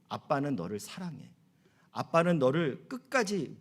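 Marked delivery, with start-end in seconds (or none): 0.1-1.3 put-on soft and low, like a father to his child; a hushed, loving father's promise; tender father. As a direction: say it gently to your daughter. Father speaking tenderly to daughter.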